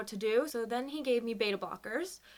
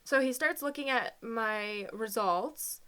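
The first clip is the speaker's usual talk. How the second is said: choked up; slower than usual. Real, not acted. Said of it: "didn't realize this was gonna be so hard".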